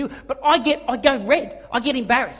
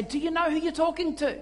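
pointed challenge; 5 semitones higher, 6 dB quieter than usual. Retold or imitated. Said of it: "grab them and say".

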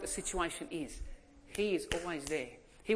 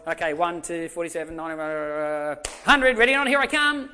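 playful counting; 9 dB louder than usual. Retold or imitated. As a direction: count like a dad teasing his kids. Dad counting for hide and seek.

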